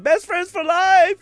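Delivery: loud, high triumphant holler; retold yell. Friend imitates Joe at the cliff jump, yelling. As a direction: shout it out as if leaping. Acting out Joe's cry on the cliff.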